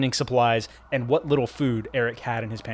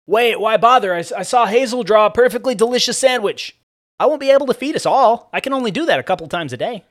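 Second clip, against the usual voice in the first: high, loud, agitated voice; worked-up argument in a raised voice; urgent objection; 10 semitones higher, 8 dB louder than usual. Acted. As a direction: protest it high and forceful. Acted excited exchange during escape.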